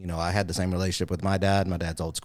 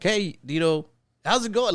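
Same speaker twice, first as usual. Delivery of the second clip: much higher, bright excited hello to dad; happy greeting; 11 semitones higher, 3 dB louder than usual. Retold or imitated. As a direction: greet him like the call made your day. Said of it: "Like, I was happy".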